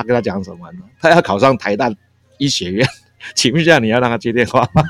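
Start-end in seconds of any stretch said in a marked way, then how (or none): 2.4-4.9 laughing. Retold or imitated. Brother's phone trick retold laughing.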